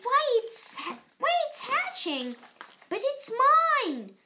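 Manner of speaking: loud, high possessive scream. A narrator's reading. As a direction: shriek to claim the egg. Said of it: "screamed the bird".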